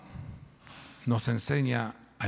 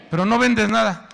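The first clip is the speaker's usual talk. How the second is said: exhorting shout; 11 semitones higher, 9 dB louder than usual. Acted. Exhorting congregation.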